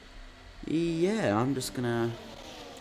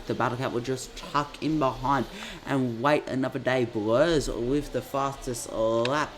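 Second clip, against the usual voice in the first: motorsport commentator; 4 dB louder than usual. Acted. Live race play-by-play.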